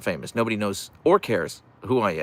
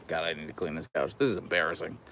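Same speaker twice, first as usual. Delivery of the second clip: ordinary talk, nothing marked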